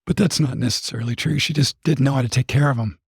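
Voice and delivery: ordinary delivery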